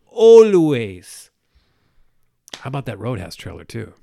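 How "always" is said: loud high bark of a punchline; shouted one-word capper. Acted. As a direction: blast it out as the capper.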